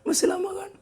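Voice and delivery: high-pitched pleading, imitating a tearful child; imitated tearful child. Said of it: "tiba-tiba nangis". (tiruan anak menangis, suara tinggi memelas)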